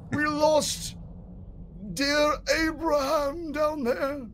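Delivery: high, slow, moaning undead noble; zombie lord. Acted. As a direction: call out like a lost old zombie lord.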